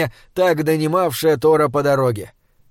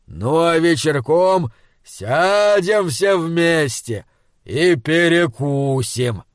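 slow, friendly giant; easygoing giant, drawn out; slower than usual. Acted. Giant offers to carry supplies.

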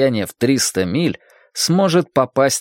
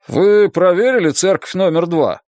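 tired displeased chief; 6 semitones higher than usual. Acted. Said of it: "Усталый и недовольный голос Рэндалфа".

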